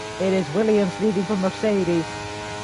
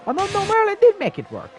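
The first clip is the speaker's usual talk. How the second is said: surprised; 4 dB louder than usual. Real, not acted. Sudden correction of the call.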